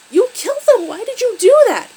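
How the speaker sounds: very loud, high-pitched outcry; distraught yelling. A narrator's reading. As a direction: yell it like an upset, accusing child. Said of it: "begins crying and yells".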